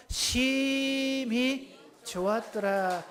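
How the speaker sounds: "심히" held very long on a high, soft note; drawn-out 심히. Shown as a demonstration. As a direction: stretch "심히" out before finishing the phrase. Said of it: "심히 할 때 한 바퀴 뺑 돌려서". ("심히"를 높고 길게 끌며)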